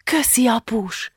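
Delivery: slow, grateful whisper; whispered thanks. A narrator's reading. Girl thanks her father in a hushed library, whispering. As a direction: whisper it to Dad, drawn out.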